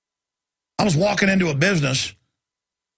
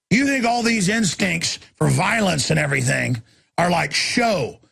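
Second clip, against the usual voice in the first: ordinary talk, nothing marked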